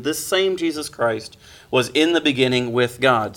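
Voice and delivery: ordinary delivery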